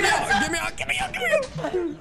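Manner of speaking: high-pitched frantic cries for help; panicked shouting. Real, not acted. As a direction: yell to be let out, voice shooting way up. Trapped player cries for help.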